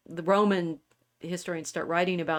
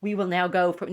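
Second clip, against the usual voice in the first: normal talking voice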